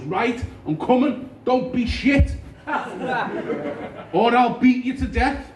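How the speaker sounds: high, loud, slow mock threat; cheerfully menacing fan; taxi driver. Taxi driver jokingly threatening the comedian, loud high voice. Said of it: "He goes".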